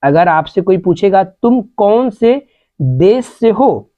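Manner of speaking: ordinary talk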